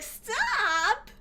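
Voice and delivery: high, stretched whine rising at the end; incredulous high-pitched parody of whining; mocking whiny echo. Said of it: "the whine".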